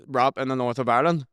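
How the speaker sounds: normal talking voice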